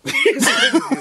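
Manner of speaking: shrieking high laugh; a sudden squeal of laughter. Real, not acted. Cracks up at partner.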